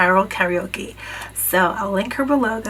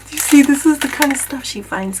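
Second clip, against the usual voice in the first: excited; 7 semitones higher, 5 dB louder than usual. Real, not acted. Excited about gift from friend.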